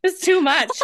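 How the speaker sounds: giddy overwhelmed outcry; laughing exasperation. Real, not acted. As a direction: exclaim it through a laugh.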